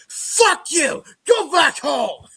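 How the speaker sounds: high, loud mock-angry shout; heckler's yell. Rival fan telling the visitor off, shouting. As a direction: bellow abuse like a hostile fan. Retold heckler yelling at him.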